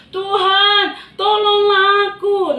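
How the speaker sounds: high, loud, slow wail for help; desperate cry. Acted. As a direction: cry out as you sink. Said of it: "dia berteriak".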